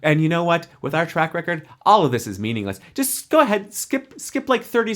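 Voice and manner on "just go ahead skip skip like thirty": high, playful push to skip ahead; playful urging. Real, not acted. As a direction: shoo listeners forward with a high voice. Telling listeners to skip ahead.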